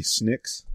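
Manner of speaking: "Snicks" with a short i for the a of snacks; mimicked Kiwi vowel. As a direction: say "Snicks" as a New Zealander says snacks. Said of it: "they were saying snacks, but, like".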